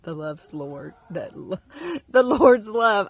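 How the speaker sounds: halting, choked-up delivery; a little emotional. Real, not acted. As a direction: falter with emotion, then push the words out. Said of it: "I get a little emotional".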